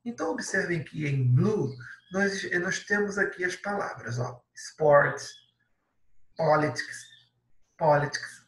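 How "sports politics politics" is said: politics said the English way, stress on the first syllable; English pronunciation. Shown as a demonstration. Teacher modelling English pronunciation. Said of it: "Cuidado com a pronúncia".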